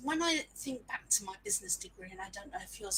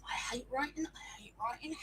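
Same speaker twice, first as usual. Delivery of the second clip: whiny whisper; 6 dB quieter than usual. Retold or imitated. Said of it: "recalled thought".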